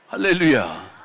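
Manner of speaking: ordinary talk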